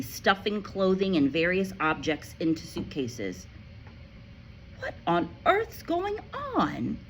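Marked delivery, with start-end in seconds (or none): 4.8-7.0 put-on high child's voice, slow and alarmed; a girl's startled cry; Matilda crying out. A narrator's reading. Matilda cries out to her parents, high and alarmed. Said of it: "she cried".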